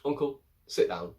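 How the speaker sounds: mild mock telling-off; curt command. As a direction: put him in his place. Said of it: "Sansa just puts him in his place".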